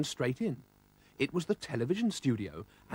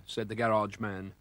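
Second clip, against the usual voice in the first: ordinary delivery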